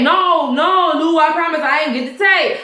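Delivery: insistent denial. Retold or imitated. Officer swears to his boss he lacks tape, insistent denial.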